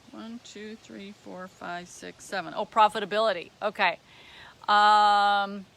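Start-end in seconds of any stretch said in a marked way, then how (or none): 0.1-2.5 very soft, under the breath; counting to oneself. Real, not acted. Presenter checking which trait is number seven, softly to oneself. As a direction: count off privately, barely voiced.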